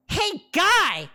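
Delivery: very high, loud call; harpy calling out the preacher; shrill harpy shout. Acted. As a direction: squawk it across the crowd. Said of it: "A shrill voice".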